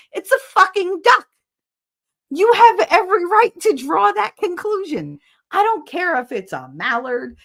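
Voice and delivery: very high, loud put-on voice; hammed-up indignant rant. Streamer defending her conclusion to chat, high mock-indignant voice. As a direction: squeal out the argument in mock outrage.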